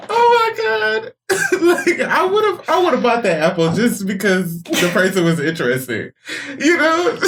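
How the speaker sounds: high squealing laughter through words; real helpless giggling fit; laughing hard. Real, not acted. Cracking up at friend's witch voice.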